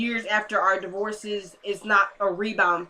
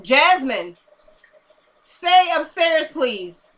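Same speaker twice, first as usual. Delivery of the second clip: high loud call to someone upstairs; slow drawn-out shout up the stairs; telling her sister to stay upstairs, calling loud; 6 semitones higher, 5 dB louder, much slower than usual. Real, not acted.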